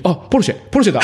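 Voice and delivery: high, excited imitation of a small boy; suddenly clear little boy. Little boy suddenly spots a Porsche driving past, blurting it out clearly. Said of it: "ポルシェめちゃめちゃはっきり言った".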